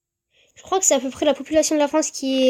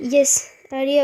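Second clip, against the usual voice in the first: ordinary delivery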